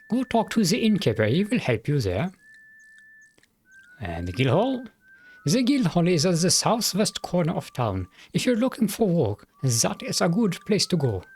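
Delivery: put-on the sheriff in a mild German accent. Sheriff telling the adventurer about the town, light German accent. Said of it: "me trying to do a German accent".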